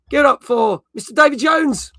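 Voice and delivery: high, loud host announcement; showman introduction. Real, not acted. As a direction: hype up the co-host.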